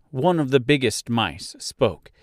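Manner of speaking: plain reading voice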